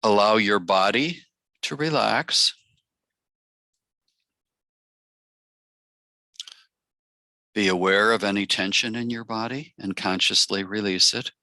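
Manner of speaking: measured meditation voice, long pauses between phrases; guided meditation. Acted. Facilitator inviting the circle to relax and open, guided meditation. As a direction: guide them gently into stillness, phrase by phrase.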